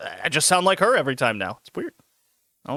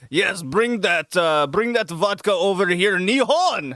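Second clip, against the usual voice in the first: bad Russian accent; 3 semitones higher, slower than usual. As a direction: start Russian, let the accent drift off at the end. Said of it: "try to do a Russian accent".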